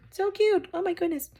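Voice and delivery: very high-pitched gushing over something cute; cooing delight. Real, not acted.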